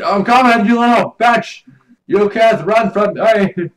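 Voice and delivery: hammed-up chase cry; mock threat. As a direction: yell it like a cartoon villain chasing someone. Chasing a player in game.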